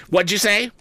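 high, sharp cry; shrill cry. A narrator's reading. Sister cries out at Orlick's insult, pitched high. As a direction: cry it out, outraged. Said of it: "cried my sister".

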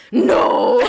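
loud breathy shout of protest; competitive yell. Retold or imitated. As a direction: yell it like losing at beer pong. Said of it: "I'd be like".

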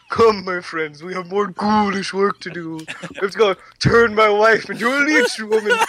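a put-on vague foreign accent for Toulon; Toulon impression. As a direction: ham up the puppet master inviting his friends.

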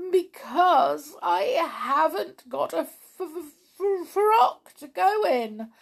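high, loud, slow wail stuttering on the F; hammed-up storybook sobs breaking up the words; sobbing. A narrator's reading. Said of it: "sobbed Tiptoe".

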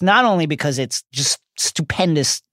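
usual speaking voice

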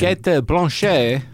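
slow, French-style Kate Blanchet; French pronunciation. Shown as a demonstration. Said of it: "she'd be Blanchet".

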